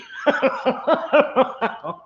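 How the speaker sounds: long high laugh; high laughter. Real, not acted. Laughs at fooling her dad.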